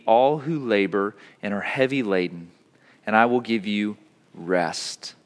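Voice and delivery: measured, solemn reading of Scripture; formal pulpit reading voice. Acted. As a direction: read the lesson to the congregation. Reads the scripture lesson aloud.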